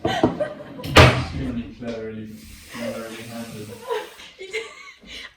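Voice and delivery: long rising embarrassed laugh. Real, not acted. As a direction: laugh it out at length. Laughing at own mistake.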